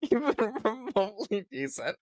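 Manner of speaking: very high, squealing through laughter; helpless real laughter pushing the voice way up; laughing hard. Real, not acted. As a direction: barely get the words out for laughing. Cracking up at the played clip.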